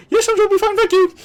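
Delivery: whimpered scream. Retold or imitated. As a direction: squeal it out in a panic while running. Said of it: "more of a whimpered scream".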